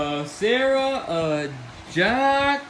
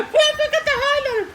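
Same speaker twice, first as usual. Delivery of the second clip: much higher, fast voice, laughing; laughing squeak; 14 semitones higher than usual. Acted. Laughing mock voice.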